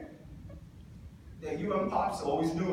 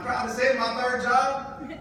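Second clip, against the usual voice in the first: excited boast; 8 semitones higher, 5 dB louder than usual. Real, not acted. Proudly hyping his third job.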